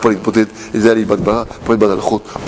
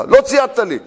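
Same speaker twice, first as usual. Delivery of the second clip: higher, scolding mock shout; mock yell; 8 semitones higher than usual. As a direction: snap it like an angry device. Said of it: "אם הוא צועק עליך". (צעקה מדומה ונוזפת בקול גבוה)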